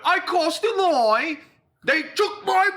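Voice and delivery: high, slow hammed-up British gangster; acting out a Peaky Blinders line, high bad British accent. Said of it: "all my fucking bad accents".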